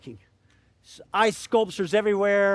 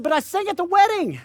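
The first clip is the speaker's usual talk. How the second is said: upset protest; 11 semitones higher, 7 dB louder than usual. Retold or imitated. Woman pleading after name missing.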